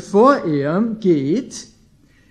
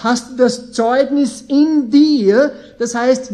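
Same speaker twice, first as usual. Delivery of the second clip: higher and louder, slow on in sich; fervent preaching; 4 semitones higher, 3 dB louder, slower than usual. Acted. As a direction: preach it out to the congregation.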